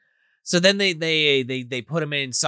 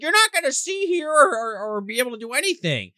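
mock warning in a much higher voice; retold doctor's warning; 12 semitones higher than usual. Doctor warns the prisoner in the chamber, high imitation. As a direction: pitch it way up, imitating her.

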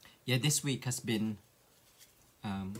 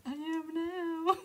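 drawn-out, very high-pitched silly voice; pinky voice; 5 dB louder, slower than usual. Pretend. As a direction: stretch it out slowly in a squeaky put-on voice. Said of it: "That's my pinky voice".

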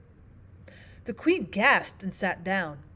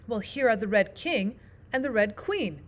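plain reading voice

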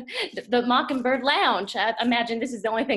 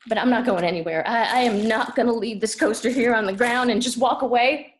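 determined; 5 dB louder than usual. Acted. Child refusing to leave until mama hears, loud and determined. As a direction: plant yourself and declare it loudly.

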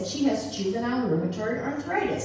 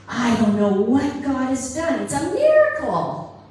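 imagined surgeon bursting with amazed joy; excited miracle announcement; 2 semitones higher, 7 dB louder than usual. Retold or imitated. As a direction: ring it out like the best news ever.

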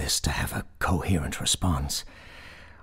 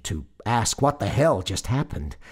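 ordinary delivery

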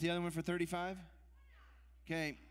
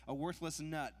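ordinary talk, nothing marked

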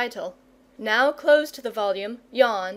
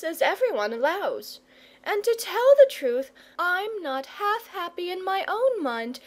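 higher-pitched, sensible mother's voice; 5 semitones higher than usual. Acted. Mother to shepherd about the lonely dragon, sensible mother. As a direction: speak up for the boy and pity the beast.

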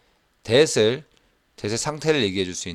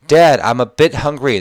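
first reading; 4 semitones higher, 8 dB louder, much slower than usual. Shown as a demonstration. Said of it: "repeated softer".

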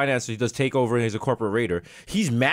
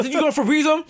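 mock question; 13 semitones higher, 3 dB louder than usual. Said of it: "Remember I said".

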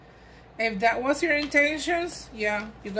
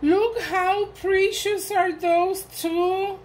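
high, slow, gushing delight; 7 semitones higher, 4 dB louder, slower than usual. Real, not acted. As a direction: savour every word in awe. Gushing over gifts.

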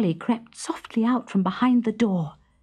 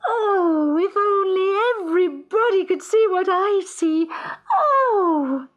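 a bright, pitched-up cry of delight; high happy singsong; 10 semitones higher, 3 dB louder than usual. Acted. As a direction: sing it out high and clear. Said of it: "sang out in a high, clear, happy voice".

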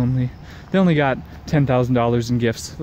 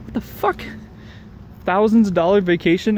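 shocked disbelief; 8 semitones higher than usual. Retold or imitated. Disbelieving outburst at a woman turning down a vacation. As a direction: pitch leaps up, disbelieving what the fuck. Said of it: "I'm like".

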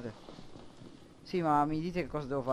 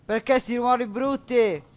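loud, much higher frightened cry; scared outburst; 8 semitones higher, 9 dB louder than usual. Real, not acted. Player spooked by ugly noises, loud frightened cry.

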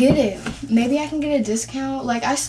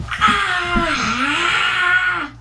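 pained cry; 8 semitones higher than usual. Pretend. Fake pregnant pain on stretching.

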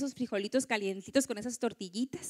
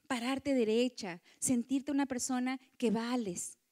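normal talking voice

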